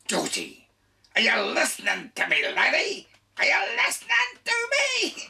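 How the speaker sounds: high, loud hammed-up father calling his lad; mock-stern Scottish father demanding his son listen; Scottish dad scolding. Father scolding his boy, Georgie.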